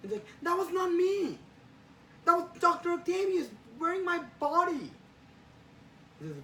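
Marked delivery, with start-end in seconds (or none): 0.4-4.9 high, loud outraged denial; indignant protest. Acted. Spider-Man denying Jonah's accusation of threats, indignant. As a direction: shout your innocence, voice pitched way up.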